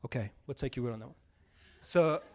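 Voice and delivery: ordinary delivery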